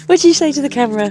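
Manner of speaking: loud, high, quick prompt; calling out. Real, not acted. Calling out to someone off-talk.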